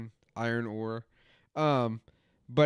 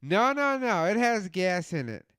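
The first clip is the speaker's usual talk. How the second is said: much higher, whiny mock denial; mocking insistent buddy; 7 semitones higher than usual. Buddy on the phone insisting the van has gas, high mocking whine. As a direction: mimic a friend refusing to believe it's out of gas.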